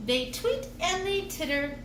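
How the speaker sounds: plain reading voice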